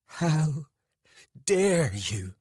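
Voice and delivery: loud, outraged, broken by a pause; trembling with rage. A narrator's reading. Lover just slapped him, speaking in rage. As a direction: force it out in fury, word by word. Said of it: "trembling with rage".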